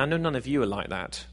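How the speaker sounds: ordinary talk, nothing marked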